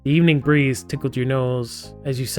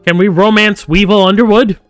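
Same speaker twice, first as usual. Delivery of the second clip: mock excitement; 6 semitones higher, 8 dB louder than usual. Mock-eager question about romance option.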